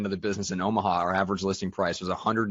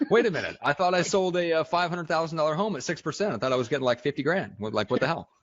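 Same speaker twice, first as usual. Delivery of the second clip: shocked disbelief; 7 semitones higher than usual. Retold or imitated. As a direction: sputter in shocked confusion. Agent shocked at first paycheck.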